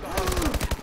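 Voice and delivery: high, audible intake of air; demonstrated breath in. Shown as a demonstration. Storyteller showing viewers a normal breath, demonstrated breath in. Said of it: "breath you drink".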